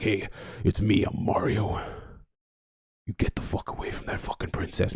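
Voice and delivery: low, husky tough-guy Mario; deep-voiced action hero. Streamer guessing at the movie's Mario voice, deep action hero. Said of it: "some fucking deep-voiced action hero voice".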